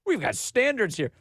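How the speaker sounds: high prissy newscaster parody; mock anchor. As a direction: sneer it in a prim high voice. Mocking the news anchor again.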